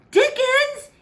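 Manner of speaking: high, loud shocked cry; outraged cry. A narrator's reading. King hearing of the forbidden book, crying out high and loud. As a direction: shriek the name in disbelief. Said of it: "he cried".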